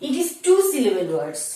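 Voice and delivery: ordinary delivery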